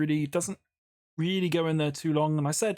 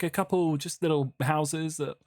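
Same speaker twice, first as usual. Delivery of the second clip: ordinary talk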